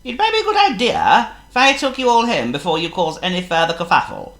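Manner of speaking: a chirpy, fussy bird; high loud blackbird voice; Charles the Blackbird. A narrator's reading.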